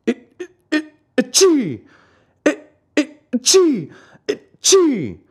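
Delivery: high, explosive mock sneezes; theatrical sneezing fit. A narrator's reading. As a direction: stutter up into three big achoos. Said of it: "Three more sneezes sounded, louder than ever".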